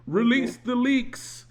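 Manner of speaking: slow, loud, grand decree; dramatic proclamation. Retold or imitated. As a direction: bellow it like a desperate studio boss. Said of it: "So they're like".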